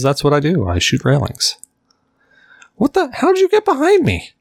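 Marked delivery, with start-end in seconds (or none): 2.8-4.3 high, shocked yelp; startled. Real, not acted. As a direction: jump out of your skin. Enemy appears behind him.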